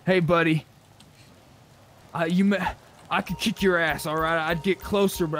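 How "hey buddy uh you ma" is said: ordinary delivery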